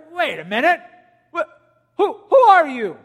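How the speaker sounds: high, loud, stammering who-are-you; shocked disbelief. Retold or imitated. Religious leaders hearing Jesus claim God's name, shocked and loud. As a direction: blurt it out as if you can't believe your ears.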